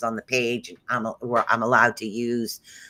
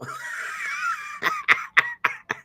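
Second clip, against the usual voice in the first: burst of laughter; 5 dB quieter than usual. Real, not acted. Laughs at talking after surgery.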